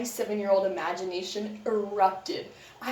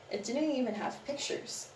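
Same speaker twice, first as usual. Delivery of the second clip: ordinary talk, nothing marked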